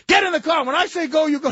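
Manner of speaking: high loud order; mock yell. Farley's character yelling at his girlfriend, high and loud. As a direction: shout it way up high.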